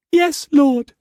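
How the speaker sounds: very high, small whimpered answer; whimpering. A narrator's reading. Mei Mei answering Struan about the child, whimper. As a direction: whimper it fearfully.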